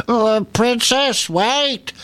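high, stretched creature call; frog calling out. A narrator's reading. Frog left behind calls to the running princess. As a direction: call after someone as a frog. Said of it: "called the frog after her".

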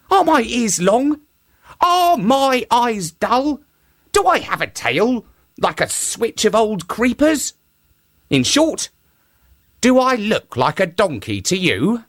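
high, mocking monkey voice; monkey mocks the shark, high; monkey's voice. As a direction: pitch it up, teasing the shark.

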